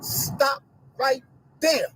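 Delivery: slow, firm halt; slow stern stop. Real, not acted. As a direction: stop them cold, slowly. Interrupting a clip.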